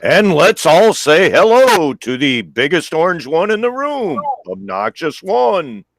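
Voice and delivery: high, loud mock emcee intro; announcer voice. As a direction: hype it like a game show host.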